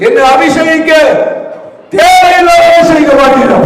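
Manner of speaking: fiery pulpit exhortation; full-force preaching. Acted. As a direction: preach it hard and climb to a shout.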